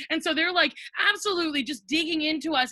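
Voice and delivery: usual speaking voice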